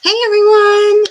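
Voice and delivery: loud, high, bright hello to chat; excited greeting. Real, not acted. Streamer greeting her chat, loud and excited. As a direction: call out a big welcome.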